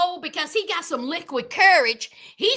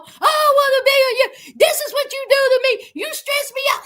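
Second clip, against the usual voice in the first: higher, louder mocking whine; whiny Daniel; 10 semitones higher, 5 dB louder than usual. Mocking Daniel whining at Bianca in the car. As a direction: mimic a drunk boyfriend whining. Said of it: "And Daniel".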